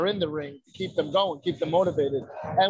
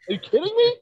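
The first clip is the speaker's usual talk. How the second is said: much higher and louder, incredulous cry; outraged disbelief; 5 dB louder than usual. Retold or imitated. Telling hosts about the doctor slapping him, outraged disbelief. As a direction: squeal it in mock outrage.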